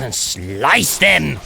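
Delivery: very slow, drawn-out attack call; hammed-up slicing war cry; battle cry. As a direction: stretch it out as the blow lands. Battle call during game play.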